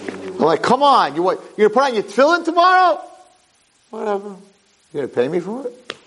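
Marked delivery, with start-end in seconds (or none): 0.6-3.0 mock urging. Urging an indifferent kid. 3.9-6.0 put-on indifferent kid. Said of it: "I call them the whatever kids".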